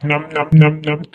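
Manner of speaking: nom-nom chewing noises; pretend munching. Child fed chutney and food by mother, munching. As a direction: chomp through the mouthful.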